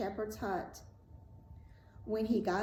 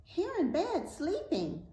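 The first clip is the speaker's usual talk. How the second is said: high, small character voice; little talking blood drop, pitched high; answering drop of blood; 9 semitones higher than usual. A narrator's reading. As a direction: pipe up in a tiny high voice.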